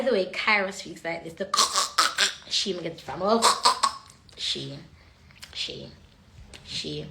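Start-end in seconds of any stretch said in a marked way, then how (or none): none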